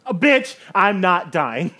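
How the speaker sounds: high, loud and sassy; loud sassy retort. Real, not acted. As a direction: shout it with attitude.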